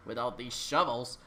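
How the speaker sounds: ordinary delivery, nothing marked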